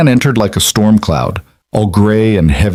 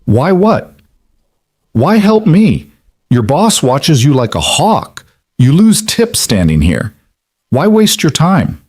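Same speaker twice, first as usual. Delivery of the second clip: intense questioning; 7 semitones higher, slower than usual. Acted. Old man demands an explanation.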